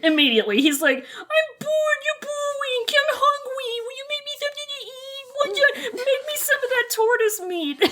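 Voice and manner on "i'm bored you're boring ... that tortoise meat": high, whiny bratty complaining. Retold or imitated. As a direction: whine like a bored brat. Voicing whiny Wei Wuxian.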